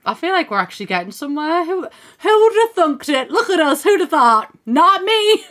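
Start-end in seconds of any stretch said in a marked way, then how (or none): 1.6-5.5 high, loud giddy laughter; gleeful and giddy; excited laughing. Real, not acted. Pleased at progress, laughing.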